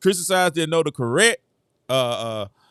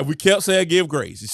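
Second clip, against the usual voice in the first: ordinary talk, nothing marked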